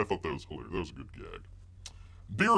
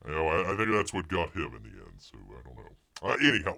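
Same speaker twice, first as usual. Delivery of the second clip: ordinary talk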